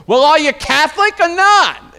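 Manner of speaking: loud, very high Boston aunt impression; high, blaring Boston-accented challenge. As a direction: imitate an exasperated Boston aunt demanding an answer. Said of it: "she's like, she's from Boston".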